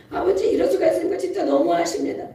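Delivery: quick, high-pitched protest; arguing with Father. Retold or imitated. Beloved child protesting to Father, quick and high. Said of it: "막 따져".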